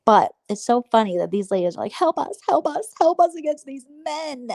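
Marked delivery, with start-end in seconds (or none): 1.9-4.5 higher, slow whiny plea for help; mock damsel plea. Mocking the ladies as helpless damsels, whiny. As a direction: plead like a helpless damsel, overdone.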